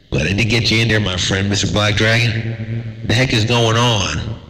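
ordinary delivery

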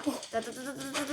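rapid-fire tongue-tapped syllables; rattling noise. Real, not acted. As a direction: run off a string of rattling syllables. Imitated noise while playing. (따다다 연달아 내는 효과음)

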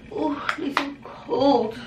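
high, slow, fluttering helpless plea; damsel in distress voice. Acted. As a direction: play the shivering damsel crying for help. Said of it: "It's my, uh, damsel in distress voice".

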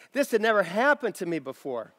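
usual speaking voice

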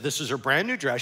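ordinary delivery

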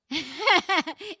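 high, loud burst of real laughter. Real, not acted. Laughs at horse joke.

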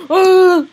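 loud, high mock scream; sudden piercing "ah"; jumpscare scream. Voices the character's jumpscare.

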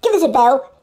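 ordinary talk

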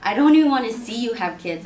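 loud, insistent objection; acted protest. Acted protest at being kept there.

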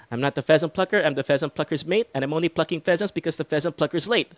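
"and I'm only plucking pheasants" rushed through at top speed; fastest tongue twister. Shown as a demonstration. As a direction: race through it without tripping. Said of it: "go a little bit faster for this last time".

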